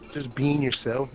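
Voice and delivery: usual speaking voice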